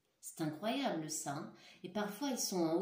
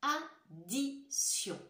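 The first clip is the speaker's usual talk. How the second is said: addition drawn out very slowly and higher; slow careful word; 7 semitones higher, much slower than usual. Shown as a demonstration. Teacher draws out addition so pupils hear S. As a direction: stretch addition, each syllable clear. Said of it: "écoutez bien le mot".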